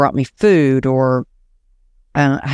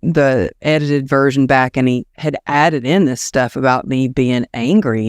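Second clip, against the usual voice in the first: usual speaking voice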